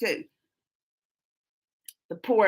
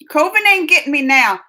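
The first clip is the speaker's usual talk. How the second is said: loud, cocky brag to the chat; defiant boast; 3 semitones higher, 10 dB louder than usual. Real, not acted. Boasting to her stream chat about her immunity, loud and cocky. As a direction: boast loudly that the virus can't touch you.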